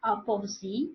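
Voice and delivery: "Opposite" as "up-of-zee", rising at the end; misheard pronunciation. Shown as a demonstration. Teacher showing the word that does not fit, misheard pronunciation. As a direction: turn "Opposite" into "up of Z" with no final t. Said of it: "this word does not fit".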